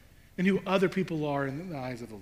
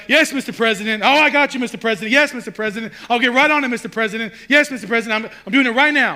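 higher, louder eager yes-man on the phone; showy phone act; 7 semitones higher, 10 dB louder, faster than usual. Retold or imitated. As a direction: perform for the listener at the door. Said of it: "and he's like".

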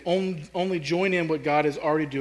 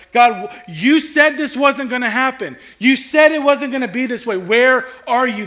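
loud, pleading, higher voice, slowing at the end; desperate cry to God; 6 semitones higher, 8 dB louder than usual. Retold or imitated. Oppressed people crying out to God, loud pleading. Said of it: "they're crying out to God, like".

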